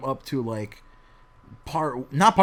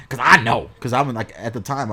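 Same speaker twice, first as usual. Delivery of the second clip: usual speaking voice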